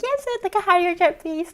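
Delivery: high, sweet, overly polite order; mock polite request. Writing to Pepsi for a jet, mock polite. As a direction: ask nicely, hammed up. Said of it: "They're like".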